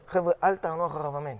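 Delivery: imitating a rabbi's hushed aside; discreet instruction.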